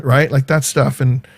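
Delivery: normal talking voice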